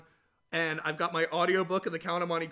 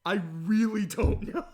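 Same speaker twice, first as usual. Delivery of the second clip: louder, breaking into a real laugh; 3 semitones higher, 6 dB louder than usual. Real, not acted. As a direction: start louder and laugh through the end. Laughs at own uncertainty about the movie.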